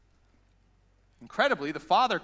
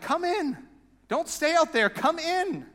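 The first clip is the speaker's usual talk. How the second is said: much higher, coaxing plea; pleading father; 9 semitones higher, slower than usual. Retold or imitated. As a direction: beg him gently to come inside. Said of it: "the father goes out, like".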